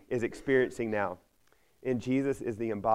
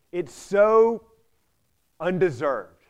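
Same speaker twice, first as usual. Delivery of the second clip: indignant outburst; 6 semitones higher, 9 dB louder, much slower than usual. Retold or imitated. Voicing the brother's outrage.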